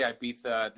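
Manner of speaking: Ibiza with a th, "eye-BEE-tha"; British pronunciation. Shown as a demonstration. Guest compares nations for host, British Ibiza with th. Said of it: "the British say Ibiza".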